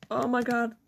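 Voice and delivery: ordinary talk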